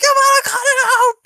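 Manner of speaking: imitated mother's furious high, loud scream; losing her mind. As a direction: scream at the kids to stop, hammed-up. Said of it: "lose her mind".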